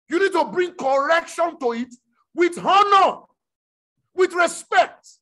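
rising to a full preaching shout. Acted. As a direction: drive it home from the pulpit.

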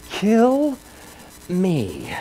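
drawn-out cry, falling away soft; smothered mock scream. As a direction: imitate a strained, muted scream. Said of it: "he was screaming".

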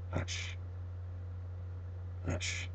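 very soft hush; soft hush as if quieting the dog. A narrator's reading. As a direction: breathe the word out, barely there. Hushing in the silence.

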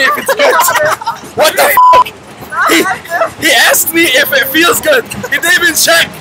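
laughing. Real, not acted. Laughing in disbelief on ride.

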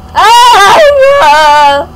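high, loud drawn-out "ah"; long held yell on take-off; loud cry. Pretend. Jumps to fly in game.

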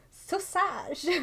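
high, playful French "Sausage", so-SAHZH; French accent. Acted. Streamer naming the style influencer, hammed-up French accent. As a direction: exaggerate the French ending on "Sausage". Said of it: "like, a French accent".

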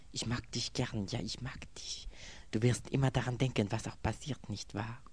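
leiser, höher, zärtlich; zärtlich erinnert. A narrator's reading. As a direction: sanft wie ein Geständnis. Erinnerte Worte des Mädchens. (erinnerte zärtliche Frauenstimme)

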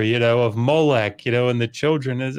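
ordinary delivery

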